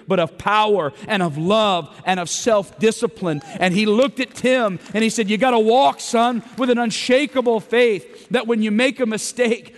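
loud, high, driving sermon delivery; full-force preaching. Acted. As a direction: preach it out to the room with fire.

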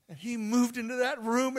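ordinary talk

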